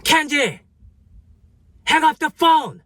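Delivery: high-pitched yell of an angry boss; manager yelling. Retold or imitated. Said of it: "she starts yelling at me".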